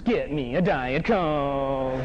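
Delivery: slow, drawn-out low chant line; slogan for audience. Shown as a demonstration. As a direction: stretch it out for the crowd to repeat.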